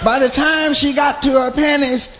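usual speaking voice

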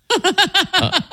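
high laughter; laughing. Real, not acted. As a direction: burst into a high-pitched laugh. Laughs at his invented caller.

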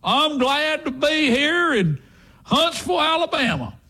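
slow, high Southern announcer drawl; hammed-up country variety-show salute in a hillbilly accent. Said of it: "this ain't hee-haw".

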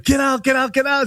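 high mock-frantic mother calling her kid off; mom yelling get out. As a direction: squeal it like a panicked mom, half laughing. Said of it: "And the mom's like".

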